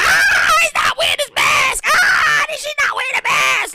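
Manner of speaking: hammed-up panic at a bare face; freaking out. Spotting someone without a mask, mock panicked shriek. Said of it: "like, you start freaking out".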